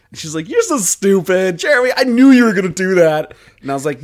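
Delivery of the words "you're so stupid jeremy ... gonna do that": loud, high mock scold; imitating friend berating Jeremy.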